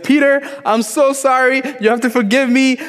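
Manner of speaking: high, frantic pleading in imitation; mock distraught sister, words tumbling out in a high voice; Laura crying.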